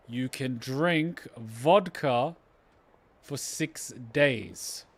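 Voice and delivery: very slow, drawn-out sales pitch; slow persuasion. Acted. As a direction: spell out the tempting offer slowly, softening at line ends. Persuading the trader with an offer.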